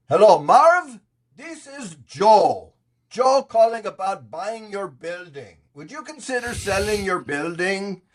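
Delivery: high, drawn-out goofy cold-call voice; idiotic caller. Retold or imitated. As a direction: act totally stupid on a sales call, slowly. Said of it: "if I act totally idiotic and stupid".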